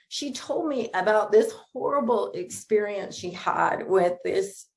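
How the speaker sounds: usual speaking voice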